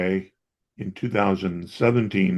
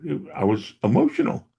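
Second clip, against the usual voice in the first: ordinary delivery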